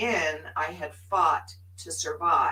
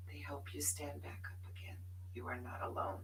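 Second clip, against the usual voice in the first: soft unvoiced whisper; hushed comforting whisper; whispered reassurance; 3 semitones lower, 14 dB quieter, faster than usual. Real, not acted. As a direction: whisper it close and kind. Intimate reassurance to audience.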